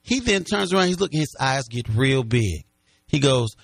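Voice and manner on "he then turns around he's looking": normal talking voice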